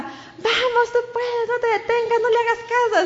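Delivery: high cheering call, far-off crowd. Retold or imitated. Spectators cheering the last runner on, high shout. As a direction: cheer him on from the sidelines. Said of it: "la gente gritaba".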